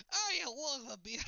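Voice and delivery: mock Scottish. Joking as a Scottish drinker, loud mock Scottish accent. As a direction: ham up a Scottish beer lover.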